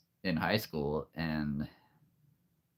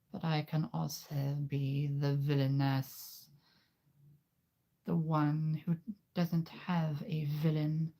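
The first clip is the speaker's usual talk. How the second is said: put-on high, slow girl-voice villainess, whispered in places; menacing little villainess plotting; 7 semitones higher, 3 dB quieter than usual. Telling nieces about a villainess, high and slow. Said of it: "And I said".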